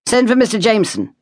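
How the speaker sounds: high yelled command; yelled order. A narrator's reading. Officer yelling to young soldier for Jameson. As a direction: call it out over the guns. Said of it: "he yelled".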